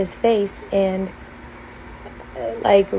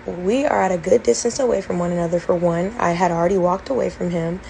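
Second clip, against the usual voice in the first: normal talking voice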